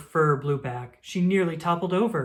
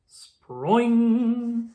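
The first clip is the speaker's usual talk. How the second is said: a louder, higher boing of a leap; big leap sound word; springy sproing; 7 semitones higher, 6 dB louder than usual. Acted. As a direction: bounce it like a spring.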